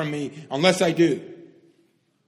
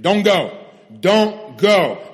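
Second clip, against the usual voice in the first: firm command; 3 semitones higher, 8 dB louder, much slower than usual. Acted. Repeated command, Jesus voiced.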